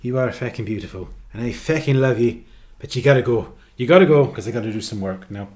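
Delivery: affectionate mock Irish brogue; Irish accent. Streamer sending the cat away to get work done, playful Irish accent. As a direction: shoo them away fondly in an Irish accent.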